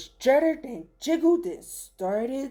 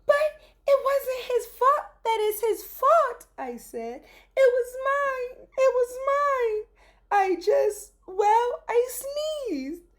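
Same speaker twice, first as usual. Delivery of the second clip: put-on high, loud child's voice; the child blurting out a confession; child confessing; 13 semitones higher, 4 dB louder than usual. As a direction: own up like an earnest little kid.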